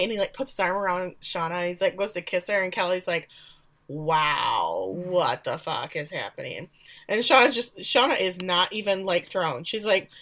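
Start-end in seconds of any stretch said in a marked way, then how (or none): 3.9-6.7 low, drawn-out disbelief; stunned deadpan. Retold or imitated. Daughter seeing her mom's lover, stunned deadpan. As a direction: drop the pitch and stretch out the wow.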